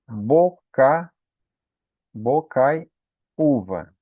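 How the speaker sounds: "bocaiúva" slowly broken as bo... cai... Ú-va; split into syllables. Shown as a demonstration. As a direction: separate the pieces of "bocaiúva", stressing the U. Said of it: "O U também, ele é tônico, tá sozinho". ("bocaiúva" devagar, sílaba por sílaba)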